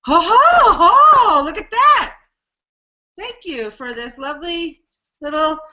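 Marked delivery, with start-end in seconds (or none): 0.0-2.2 loud high laughing ho ho ho; laughing with delight. Real, not acted. Delighted at new mic.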